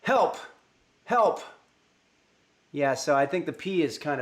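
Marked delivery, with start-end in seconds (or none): 0.0-1.4 loud punchy help twice; loud mic test. Shown as a demonstration. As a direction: hit the P hard on help. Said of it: "I think I'm pretty good".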